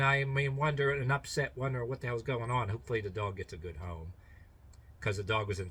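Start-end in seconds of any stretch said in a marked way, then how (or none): none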